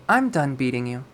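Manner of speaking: plain reading voice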